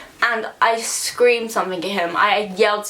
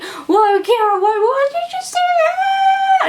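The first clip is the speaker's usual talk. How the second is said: high, loud cry of alarm from the friends; friends' shocked shout; 5 dB louder than usual. Retold or imitated. Friends pulling her away shout in shock. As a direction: shriek it like a startled friend.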